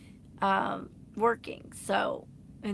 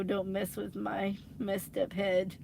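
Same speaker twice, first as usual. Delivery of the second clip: usual speaking voice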